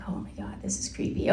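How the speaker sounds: breathy unvoiced hush, creeped out; spooked whisper. Guide alone in the lighthouse, whispering in fright. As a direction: whisper it like something's listening.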